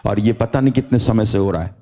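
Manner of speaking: ordinary delivery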